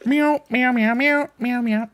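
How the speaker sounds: high squeaky kitten meows; cat meows. Pretend. Streamer meowing at chat, high kitten voice. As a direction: meow like a tiny cat.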